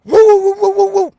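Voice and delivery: high, loud woo-woo barking like a dog; imitating the dog's alarm bark; dog howl imitation. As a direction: howl like the dog getting worked up. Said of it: "he's like".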